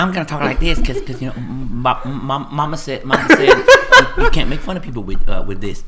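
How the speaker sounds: lightly voiced Waterboy stammer; Bobby Boucher impression. Guest mocking the slurred speech, in a laughing Bobby Boucher voice. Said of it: "All right, Bobby Boucher".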